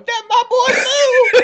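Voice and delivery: loud, very high drawn-out cracking shout; high-pitched cracking voice. Retold or imitated. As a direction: holler it in a squeaky, breaking imitation. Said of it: "this really high-pitched voice where it kinda cracks".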